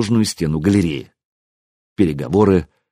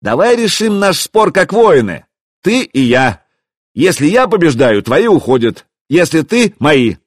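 raised, higher call; shouted challenge; 6 semitones higher, 4 dB louder than usual. A narrator's reading. Hero offering the prince a duel, shouting. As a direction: call out a challenge across the yard. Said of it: "крикнул я".

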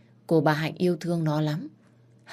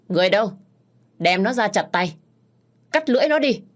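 loud, commanding order rising higher; angry order; 5 semitones higher, 6 dB louder, faster than usual. Acted. Master orders servants to cut the maid's tongue, loud and furious. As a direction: roar out the punishment.

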